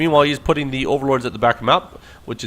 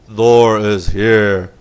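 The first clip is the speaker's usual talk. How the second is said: very slow, lower and louder mech voice; second, lower go at the Thor voice; deep Thor robot voice; 4 semitones lower, 6 dB louder, much slower than usual. Pretend. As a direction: stretch every word like a lumbering war machine. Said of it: "That's how they talk".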